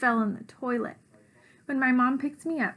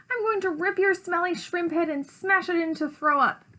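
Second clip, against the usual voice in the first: high-pitched storybook threatening; furious threat; 7 semitones higher, 3 dB louder than usual. A narrator's reading. Rosabelle attacks Ridley and threatens him, furious. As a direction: threaten like a sister in a rage.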